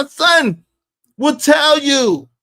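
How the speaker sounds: ordinary delivery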